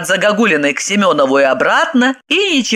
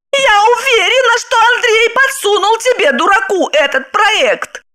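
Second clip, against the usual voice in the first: shouting wife. Acted. Wife advances on husband, shouting high. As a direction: yell it at him. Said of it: "кричала она".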